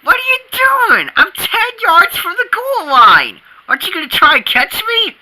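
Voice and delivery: put-on high, agitated kid's protest; high-pitched indignant rant; exasperated yelling. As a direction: complain loudly at a useless teammate. Acted football quarrel.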